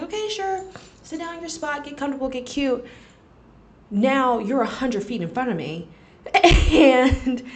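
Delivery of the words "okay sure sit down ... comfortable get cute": high, fast overly sweet politeness; sugary sweet accommodating. Retold or imitated. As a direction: chirp it up like fake friendliness. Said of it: "So I'm like".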